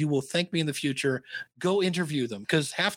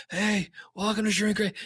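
higher, distinctive podcast-host voice; Doctor Dave's voice; 6 semitones higher than usual. Retold or imitated. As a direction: mimic a friend's trademark show intro. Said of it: "Doctor Dave kinda sounds like this".

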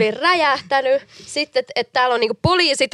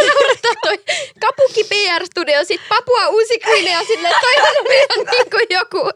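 giggling; 8 semitones higher than usual. Real, not acted. Giggling about the name.

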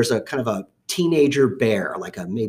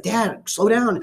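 son pleading; 10 semitones higher, much slower than usual. Retold or imitated. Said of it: "he's like".

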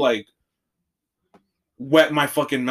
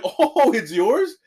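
imitating amused supervisor; 8 semitones higher, much slower than usual. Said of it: "And he starts laughing. He's like".

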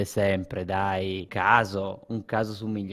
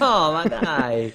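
laughing; 5 semitones higher, 5 dB louder, slower than usual. Real, not acted. Laughing at friend's answer.

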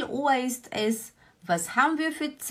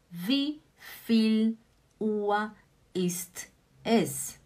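word by word; much slower than usual. Shown as a demonstration. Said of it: "teacher splits phrase word by word".

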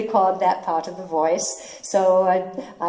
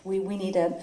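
normal talking voice

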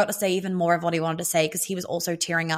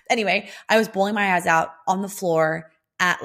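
normal talking voice

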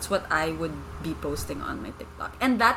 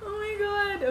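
embarrassed surprise. Real, not acted. Embarrassed at search results.

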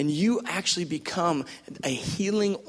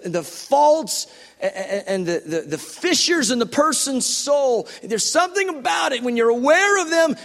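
usual speaking voice

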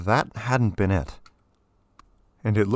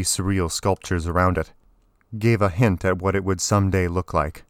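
usual speaking voice

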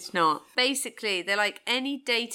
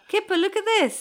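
calling the cat; 9 semitones higher, 6 dB louder than usual. Real, not acted.

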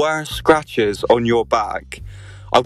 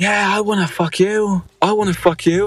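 imitating a drunk shout; 8 semitones higher than usual. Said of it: "Fucking shout out".

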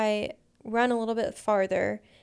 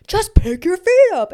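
loud, high snap of impatience; exasperated shout, pitched way up; yelling; 9 semitones higher, 13 dB louder than usual. Retold or imitated. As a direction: mimic an annoyed partner's yell. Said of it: "And he yells at me".

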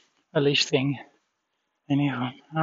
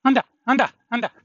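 urging call; 7 semitones higher, faster than usual. Real, not acted. Urging the dog on.

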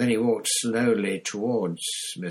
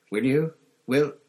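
louder, higher, more urgent demand; urgent repeat; 7 semitones higher, 8 dB louder than usual. A narrator's reading. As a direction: snap the question, losing control.

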